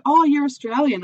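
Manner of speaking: high, bright recognition; light imitation of a stranger spotting an accent; mock surprised quote. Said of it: "people do that too".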